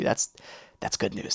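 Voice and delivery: whispered that's good news; relieved whisper; whispered relief. Real, not acted. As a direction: breathe it out in relief under your breath.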